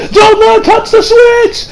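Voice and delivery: high, drawn-out cry of alarm; imitated frantic shout; desperate warning yell. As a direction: yell it across to a teammate.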